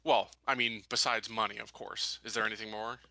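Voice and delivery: ordinary talk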